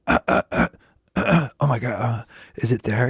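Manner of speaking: halting, quiet grunts to check the voice; croaky little morning voice check, half worried; testing the voice. Acted. Singer overthinking the voice on waking, testing it anxiously. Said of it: "you start going".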